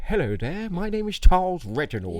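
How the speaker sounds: high, loud posh English gentleman. Retold or imitated. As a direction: put on a plummy upper-class voice. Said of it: "I used to do a posh voice".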